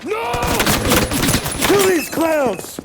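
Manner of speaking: high, loud slow bellowed order; enraged shout for help; furious yelling. Acted. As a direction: roar at the gunmen. Boss yelling orders under attack.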